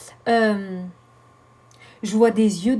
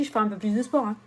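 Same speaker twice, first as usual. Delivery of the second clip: ordinary talk, nothing marked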